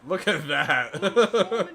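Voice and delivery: slow, drawn out with a laugh. Real, not acted. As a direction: chuckle through it slowly.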